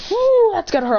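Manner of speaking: high, loud wince at the hit; wincing exclaim. Acted. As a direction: cringe out loud for him. Watching friend take a hit.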